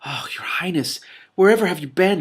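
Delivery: high, loud, overjoyed cry; old nurse crying out to her lost princess. A narrator's reading. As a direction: pour out relief at finding her. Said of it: "she cried".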